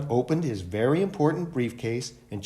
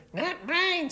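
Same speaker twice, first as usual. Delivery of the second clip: put-on much higher child's voice. A narrator's reading. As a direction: answer like a small child. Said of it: "said one child".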